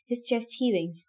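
ordinary delivery

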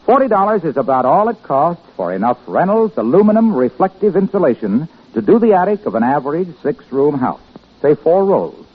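ordinary delivery

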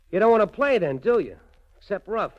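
angry. Acted. Slapped gangster turning on the lady, loud and angry. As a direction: snap back after the slap.

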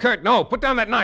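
shouted high and loud to stop him; urgent shout. Acted. Fenneau shouting at Kurt to put the knife down, loud and urgent. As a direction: yell at Kurt to drop the knife.

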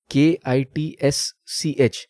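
each letter of के आई टी एस सी एच said separately and slowly; spelling out. Shown as a demonstration. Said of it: "इसका pronunciation होगा". (के आई टी एस सी एच एक-एक अक्षर करके धीरे बोलें)

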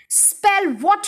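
high, rising, impatient repeat; exasperated headmistress. A narrator's reading. Headmistress corrects the confused boy, exasperated.

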